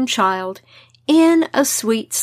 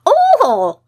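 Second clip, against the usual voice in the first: high shrill shriek; 12 semitones higher, 5 dB louder than usual. Acted. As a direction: let out a sudden piercing shriek. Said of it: "shrieked the imp".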